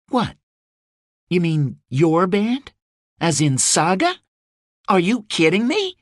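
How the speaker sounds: high, disbelieving; are you kidding me; incredulous. A narrator's reading. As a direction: Rose learning only the band is coming. Rose dismayed at the band.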